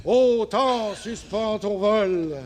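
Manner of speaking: slow grand theatrical declamation; Sarah Bernhardt. Shown as a demonstration. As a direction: declaim it like a tragic diva. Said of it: "faites-moi Sarah Bernard".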